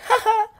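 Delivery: high-pitched mock "ha ha"; mocking laugh. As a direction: taunt with a quick, high fake laugh. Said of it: "he's like".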